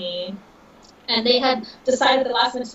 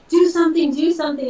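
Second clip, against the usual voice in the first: raised, insistent plea, higher and louder; retold yelling; 6 semitones higher, 6 dB louder, faster than usual. Yelling at her mom on the beach, retold raised plea. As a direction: push it out urgently, a mock panicked yell. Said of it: "I was yelling at my mom".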